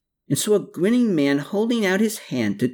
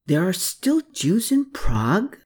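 high-pitched, incredulous question; shocked; 9 semitones higher than usual. A narrator's reading. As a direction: ask it in shocked disbelief. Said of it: "with an abundance of shock".

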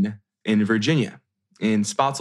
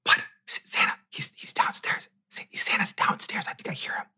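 hushed, thrilled whisper, softer and softer; excited whisper; 10 semitones higher, 6 dB quieter than usual. Retold or imitated. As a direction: whisper the secret that Santa's here. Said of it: "She's whispering to me".

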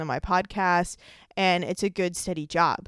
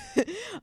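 high, squeaky evil cackle; evil witch cackle; 13 semitones higher than usual. Real, not acted. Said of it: "I sound like a evil witch".

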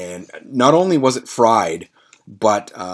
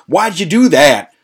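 loud, higher angry demand; retold anger; 7 semitones higher, 7 dB louder than usual. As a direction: demand it like a furious man. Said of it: "He was really fucking mad".

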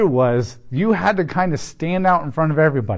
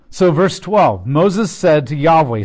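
ordinary talk

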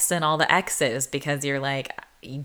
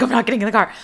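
louder, higher, pushing back; mock yelled refusal; 7 semitones higher, 7 dB louder, much faster than usual. A girl yelling back from the street at him, mock shouted refusal.

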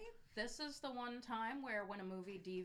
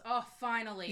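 relieved shout; 4 semitones higher, 11 dB louder than usual. Retold or imitated. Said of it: "dies and he says".

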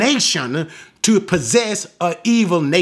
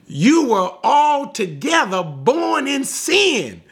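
higher, drawn-out mock accusation; accusing Pharisees; 7 semitones higher, slower than usual. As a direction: act out a slow, high-pitched condemnation. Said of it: "Notice they said to him".

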